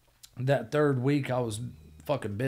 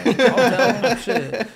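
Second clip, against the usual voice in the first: sarcastic mock yes; 9 semitones higher, 10 dB louder than usual. Sarcastic retold reply, laughing.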